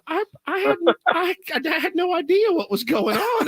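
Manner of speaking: very high mock female voice; imitated laughing woman. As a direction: stammer it out high, imitating her amusement. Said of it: "She started dying laughing. She goes".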